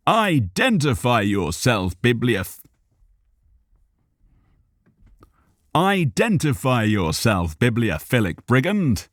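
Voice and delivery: lofty, commanding challenge; grand demand. A narrator's reading. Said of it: "Cal demanded grandly".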